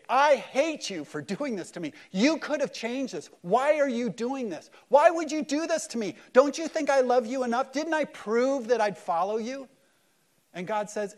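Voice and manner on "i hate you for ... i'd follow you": loud, high, anguished railing; building from raised voice to pleading shout; angry accusation. Acted. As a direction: hurl furious questions up at God. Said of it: "shake your fist and say".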